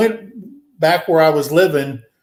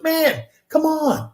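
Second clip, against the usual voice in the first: drawn-out, high-pitched retold plea; slow, high coaxing quote; high-pitched pleading; 13 semitones higher, slower than usual. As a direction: imitate a buddy urging, pitched way up and slow. Buddy urging him, retold quote.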